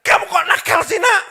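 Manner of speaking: high, loud scolding shout; mother yelling. Acted. Mother shouting at her jumping child, yelling scold. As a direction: yell it at the child in exasperation. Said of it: "ibunya teriak".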